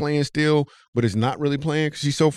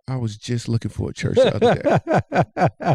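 laughing fib; 2 semitones higher than usual. Retold or imitated. Eager lie to pretty woman.

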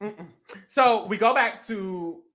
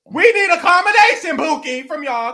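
mock call; 7 semitones higher, 6 dB louder than usual. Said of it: "They're like".

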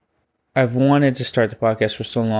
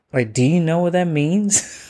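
higher-pitched mock bafflement; amused disbelief; 6 semitones higher than usual. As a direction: question mom in playful disbelief. Retells baffled question to mom.

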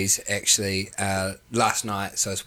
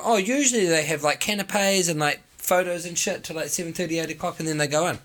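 higher, casual know-it-all mate explaining; imitating Josh; 5 semitones higher than usual. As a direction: do your mate explaining it off-handedly, high. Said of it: "What he's done is he's gone".

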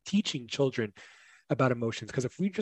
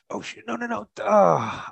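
retold dismay; 8 semitones higher, slower than usual. Retold dismay at own parenting mistake.

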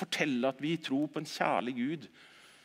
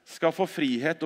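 ordinary delivery

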